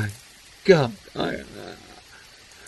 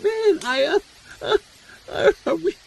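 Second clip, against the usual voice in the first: much higher, stuttering with fear; panicked stammer; 13 semitones higher than usual. Acted. Terrified friend stammering at the hunter about safety. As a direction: voice climbing in panic, tripping over words.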